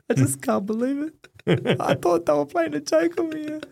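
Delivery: high-pitched imitation of his own tearful voice; retold crying. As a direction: replay yourself choking up, voice pushed up high. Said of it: "started crying".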